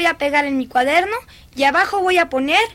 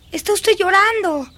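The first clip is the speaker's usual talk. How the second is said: higher, more insistent exclamation; insistent surprise; 5 semitones higher than usual. Acted. Girl confronts weeping governess, insistent surprise. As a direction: raise the pitch as it sinks in.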